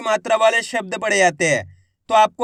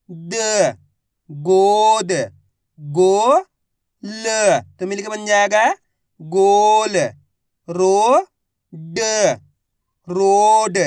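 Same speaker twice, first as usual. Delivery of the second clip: put-on loud, slow syllable-by-syllable reading for children; phonics drill; 4 dB louder, much slower than usual. Shown as a demonstration. Teacher sounding out Hindi words for young students, slow and loud. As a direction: sound out each syllable, then blend the word.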